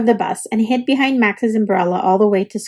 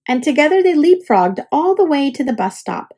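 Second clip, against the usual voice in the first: usual speaking voice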